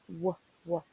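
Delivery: "W, w" as two short "wuh" sounds; letter sound demo. Shown as a demonstration. Teacher models the sound before example words, "W, w" said as "wuh, wuh". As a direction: say "W, w" as the bare consonant sound, not the letter name. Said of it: "w sound".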